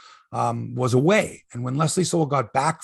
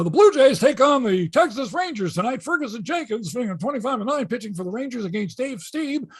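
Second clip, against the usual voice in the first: higher, lively play-by-play announcer read; radio sports report; 8 semitones higher than usual. Retold or imitated.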